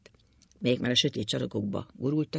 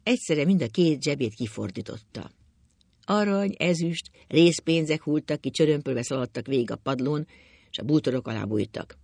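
ordinary delivery, nothing marked

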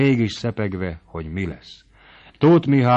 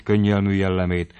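ordinary delivery

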